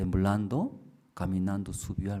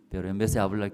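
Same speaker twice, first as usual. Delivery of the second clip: ordinary talk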